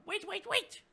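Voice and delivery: high urgent goblin cry; squeaky goblin call. Acted.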